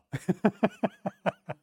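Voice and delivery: a quick amused laugh; short laugh. Real, not acted. Laughing at telling the pastor, a quick laugh. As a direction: laugh at his own story.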